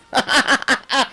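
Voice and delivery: high, gleeful run of laughter; real laugh. Real, not acted. Streamer telling the Mars bar tale, laughing high. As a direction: crack up at your own punchline.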